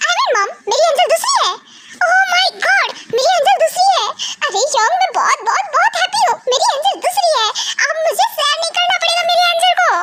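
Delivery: put-on suddenly ecstatic kid, laughing; overjoyed. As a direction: burst out with giddy joy after the tears. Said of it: "एक second में हंसने लगता है".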